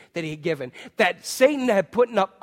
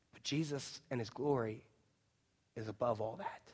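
sudden much softer, lower reverence; hushed; 7 semitones lower, 16 dB quieter than usual. Acted. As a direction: drop to a near hush for awe. Sudden drop after loud preaching.

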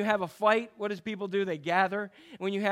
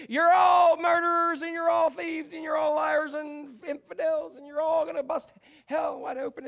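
street preacher shout; 9 semitones higher, 5 dB louder than usual. Retold or imitated. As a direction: condemn the whole crowd from the sidewalk. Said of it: "She'd walk back and say".

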